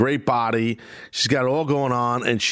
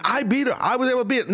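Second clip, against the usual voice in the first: higher, giddy fangirl bragging; mock fangirl gloat; 10 semitones higher, faster than usual. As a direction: play the starstruck champ, pitched up. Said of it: "she almost made it like".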